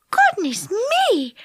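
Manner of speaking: high, drawn-out amazement; wonder. A narrator's reading. Fairy seeing something peculiar coming, high and wondering. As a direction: stretch it slowly in high-pitched wonder. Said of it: "said Silky in wonder".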